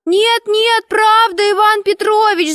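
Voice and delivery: put-on very high little-girl squeal; squealing schoolgirls. A narrator's reading. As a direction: pipe up eagerly like a chorus of girls. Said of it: "запищали девочки хором".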